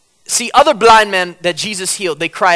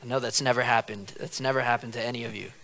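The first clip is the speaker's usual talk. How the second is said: sarcastic; 6 semitones lower, 11 dB quieter than usual. Real, not acted. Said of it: "ironic aside".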